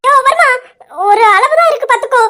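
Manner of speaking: high, loud, indignant scolding; angry scolding. Acted. Wife scolds husband ignoring her.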